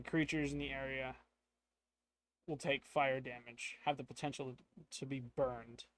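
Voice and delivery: normal talking voice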